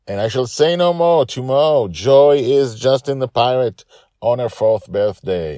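high, loud, bursting with it; excited reveal. Real, not acted. Narrator gives away the next episode to listeners excitedly. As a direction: blurt out the secret gleefully.

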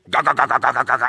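"Ga, ga, ga, ga" complaining mutter; mock grumbling. Pastor acting out his own complaining, mock grumble. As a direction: grumble like a sulky worker. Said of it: "I'm gonna start complaining".

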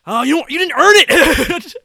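high, loud, slow mock outrage; mock indignation. Acts out indignant reaction to heroine.

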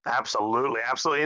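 usual speaking voice

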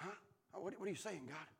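very soft, low questioning; hushed puzzlement. Acted. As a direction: answer a whisper in a hush. Elijah straining to hear God's whisper.

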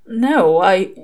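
"No" lifted, "I" left hanging; trailing off. A narrator's reading. Crowley falters answering about "No, I", trailing off. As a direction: break off after "I", unfinished. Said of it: "he trailed off".

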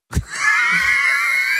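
high-pitched burst of laughter; long, high cackle. Real, not acted. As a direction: crack up in one long squeal. Cracks up mid-story.

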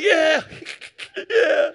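loud, high cheering yeahs broken by a laugh; joyful shout. Retold or imitated. Preacher reliving his own cheer at the baptism, loud whooping. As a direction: whoop along with the crowd, laughing between. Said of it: "I'm like".

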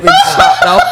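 talking through laughter; laughing. Real, not acted. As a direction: laugh out the words.

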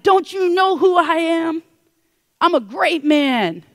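slow, high, indignant shout; mad. Acted. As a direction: shout it with outraged self-importance, drawing out the words. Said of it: "Naaman's mad".

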